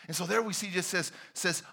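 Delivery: ordinary talk, nothing marked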